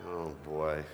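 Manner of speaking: ordinary talk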